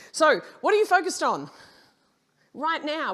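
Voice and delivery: loud and high, calling the room out; loud challenge. Real, not acted. Speaker to audience, loud pressing question. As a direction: throw the question hard at the crowd. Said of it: "asks audience loudly".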